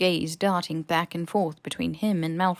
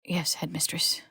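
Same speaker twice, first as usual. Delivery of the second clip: whispered, drained reply; barely a breath, exhausted boy; hushed and hollow; meek whisper; 5 dB quieter than usual. A narrator's reading.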